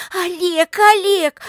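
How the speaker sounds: high, loud urgent calling; relieved joyful call. A narrator's reading. Lika rushing to Oleg on the road, joyful high cry. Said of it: "испытывая от встречи с Олегом радость".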